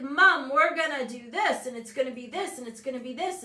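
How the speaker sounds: eager, rising list of dreams; excited son imitation. Son pitching his huge plans to mom, excited. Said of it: "He's like".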